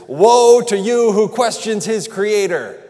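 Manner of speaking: loud, slow booming rebuke as God; thundering God. Acted. Preacher voicing God rebuking his people, loud and booming. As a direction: boom it out like an angry God.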